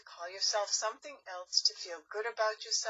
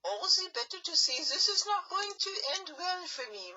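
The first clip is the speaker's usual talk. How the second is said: fake granny voice; 8 semitones higher than usual. Wolf posing as granny.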